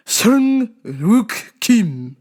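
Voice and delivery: Sung Wook Kim said slowly, with Korean sounds; slow, careful Korean reading of Sung Wook Kim; Korean pronunciation. Shown as a demonstration. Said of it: "I must say it Korean".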